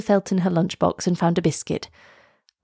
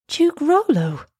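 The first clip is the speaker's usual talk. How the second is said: high-pitched shocked question; astonished girl, pitched high; gasped; 9 semitones higher, slower than usual. A narrator's reading. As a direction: gasp the name in disbelief. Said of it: "Elsa gasped".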